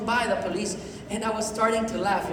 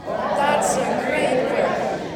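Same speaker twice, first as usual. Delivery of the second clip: very slow, drawn-out praise; repeat-after-me demo; 3 semitones higher, much slower than usual. Shown as a demonstration. Leading the audience in repetition, very slow and drawn-out. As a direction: stretch every word for the group to follow. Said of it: "Can you repeat after me".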